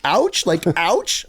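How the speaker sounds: condescending American director, imitated; berating director. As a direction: drill the word slowly, in someone's face. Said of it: "got in my face and was like".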